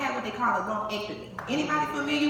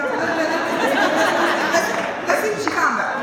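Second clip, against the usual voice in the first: urgent; 7 semitones higher, 8 dB louder, faster than usual. Retold or imitated. Urging mom to stay.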